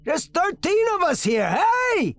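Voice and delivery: shouting at the prosecutor who ignores him; yelling. Acted. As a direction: yell to be noticed. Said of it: "I yelled at the D A".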